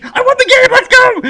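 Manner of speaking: very high, loud getaway shout; frantic yell. Pretend. Friend imagined running out with the game, frantic yell. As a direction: yell it urgently with laughter breaking in.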